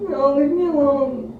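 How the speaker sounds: loud, hammed-up fake crying; pretend-upset husband shouting at wife to go away; mock upset. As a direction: push the partner away with a loud fake sob.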